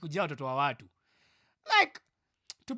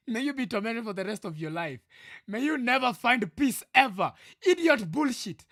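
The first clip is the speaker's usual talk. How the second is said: loud, high, building tirade; angry cursing; 3 semitones higher, 5 dB louder than usual. Real, not acted. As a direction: pronounce a curse, louder with each line. Cursing the friend who broke streak.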